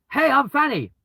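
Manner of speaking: high, loud, bright-eyed hello; chirpy girly intro. Shown as a demonstration. A woman introducing herself to strangers, high and chirpy. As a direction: chirp it like an innocent young woman. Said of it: "walk up to a bunch of people and go".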